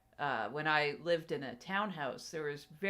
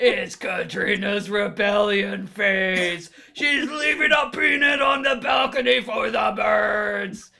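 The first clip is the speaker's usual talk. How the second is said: slow booming wrestling promo shout; wrestler voice; 4 semitones higher, 11 dB louder than usual. Pretend. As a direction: roar it like a pro wrestler hyping a match. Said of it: "wrestler voice".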